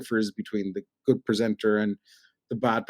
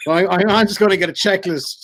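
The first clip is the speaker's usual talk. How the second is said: ordinary talk, nothing marked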